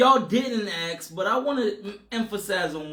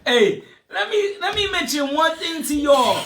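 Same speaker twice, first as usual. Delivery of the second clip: high, loud, laughing through words; 9 semitones higher, 7 dB louder than usual. Real, not acted. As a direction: laugh while you talk. Defends himself while laughing.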